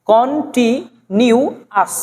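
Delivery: "কন্টিনিউয়াস" slowly as kon-ti... niu... as; syllable by syllable. Shown as a demonstration. Teacher showing the ous ending, "কন্টিনিউয়াস" slowly split. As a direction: break "কন্টিনিউয়াস" into parts, ending as. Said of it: "এই তিনটে মিলে হবে আস".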